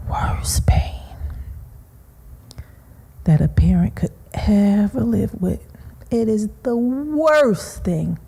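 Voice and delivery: barely whispered, breath almost gone; real grief pressing the voice down to a whisper; grief-stricken hush. Real, not acted. Mother recalling her murdered daughter, broken whisper. Grieving mother on worst pain.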